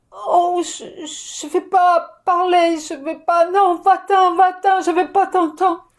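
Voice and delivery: put-on high, loud childish protest; a small child shouting go away; upset child refusal. Child pushing away an intrusive voice, loud childish protest.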